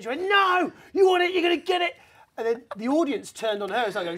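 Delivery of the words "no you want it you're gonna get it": high, loud mock shout; hammed-up heckler threat; threatening heckler.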